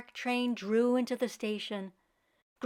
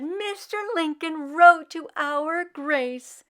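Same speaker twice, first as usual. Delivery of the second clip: higher, louder, slow wonder; mother amazed and proud; proud amazement; 5 semitones higher, 7 dB louder, slower than usual. Acted. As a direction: marvel at it out loud. Mama amazed by the letter.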